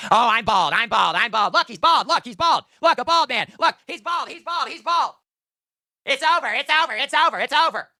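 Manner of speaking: high squeaky mock despair; mocking whiny voice. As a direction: parody a crybaby wailing about going bald. Mocking whiny bald complainer.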